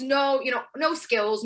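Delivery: ordinary talk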